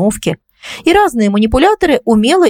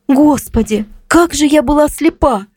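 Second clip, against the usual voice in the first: higher-pitched, understated self-reproach; mild lament; 7 semitones higher than usual. A narrator's reading. Кристина lamenting her blindness to a friend, mildly distressed. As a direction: lament it in a raised voice, lightly acted. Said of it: "начала сокрушаться Кристина".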